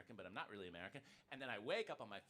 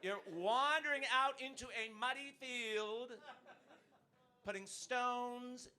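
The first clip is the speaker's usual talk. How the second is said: mock mystical Brit; 10 semitones higher, 6 dB louder, slower than usual. Mocking British pagan ritual.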